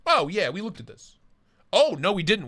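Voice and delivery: normal talking voice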